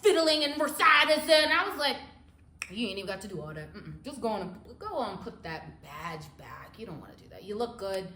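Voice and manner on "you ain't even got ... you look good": soft, low coaxing to the cop; coaxing sweet talk. Retold or imitated. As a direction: talk him down gently and quietly. Sweet-talking cop to leave.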